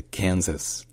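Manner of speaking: "Kansas" slowly, with the stress on KAN; "Kansas" said KAN-zas, slowly. Shown as a demonstration. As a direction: lean on the first syllable of "Kansas". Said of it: "l'accent tonique est sur le can".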